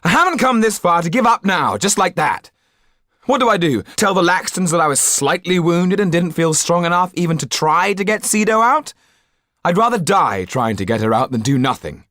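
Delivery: high, loud defiant outburst; heated protest; angry insistence. Acted. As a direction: refuse to back down, loudly. Yann refuses to give up.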